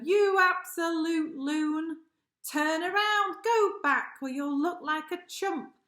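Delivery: scolding camel. Acted. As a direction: bellow it down from the dune. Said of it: "it told the hippo".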